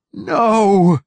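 very high, loud scream of no. A narrator's reading. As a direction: scream as he is dragged away. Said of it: "screamed Martin Silenus".

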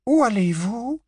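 high voiced question from the young women; asked in unison, lifted high; Tonks and Fleur asking. A narrator's reading. Tonks and Fleur ask together.

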